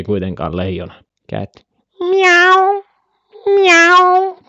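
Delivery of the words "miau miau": loud, very high mock meow; cat meow. Streamer showing what a cat sounds like, high loud meow. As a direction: squeal out a drawn-out kitty meow. Imitating the cat just named.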